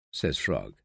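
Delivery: plain reading voice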